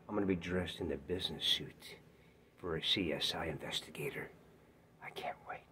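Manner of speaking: unvoiced whisper. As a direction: whisper it like a secret. Whispered aside.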